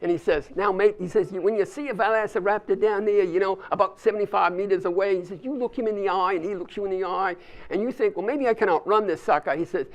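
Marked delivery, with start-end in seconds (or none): 0.5-1.0 put-on light Aussie hunter accent; a touch of Australian twang. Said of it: "I also love to do Australian accents". 1.3-5.2 put-on light Aussie hunter accent; a touch of Australian twang. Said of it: "I also love to do Australian accents". 5.4-9.6 put-on light Aussie hunter accent; a touch of Australian twang. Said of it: "I also love to do Australian accents".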